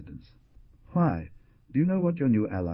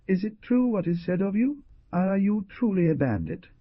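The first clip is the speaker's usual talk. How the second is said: much higher, incredulous questioning; shocked indignation; 12 semitones higher, 3 dB louder than usual. A narrator's reading. Hunter confronting the young man as a bandit, shocked and indignant. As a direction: plead for the truth, appalled and high-pitched. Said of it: "shuddered with surprise and indignation".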